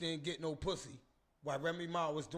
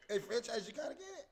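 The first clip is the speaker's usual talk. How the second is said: teasing call-out; 11 semitones higher, faster than usual. Acted. Calling out to friend teasingly.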